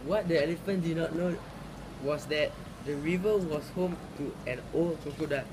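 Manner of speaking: ordinary delivery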